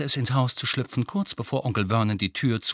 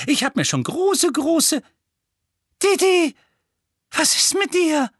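high, loud fussing aunt; alarmierte Mutter; 6 dB louder than usual. Acted. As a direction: voice leaping up in worry for your boy. Aunt fusses, grows alarmed at son. (hohe, besorgte Mutterstimme)

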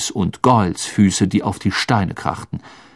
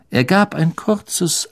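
plain reading voice

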